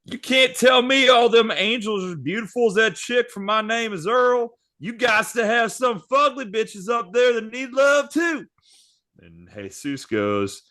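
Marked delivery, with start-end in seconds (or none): 0.0-8.5 Bubby's voice. Acted. Host voicing Bubby arguing with Jesus, high and brash. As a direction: pitch it way up for Bubby's cheeky reply. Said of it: "To which Bubby goes".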